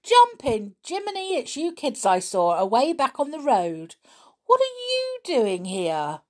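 high, loud surprised yell; Nobby yelling in surprise. Acted. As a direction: shout in amazed recognition. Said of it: "he gave a yell".

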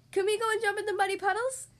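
put-on high, excited little-girl voice; eager child. A narrator's reading. Peppa asking to play in puddles, high eager child voice.

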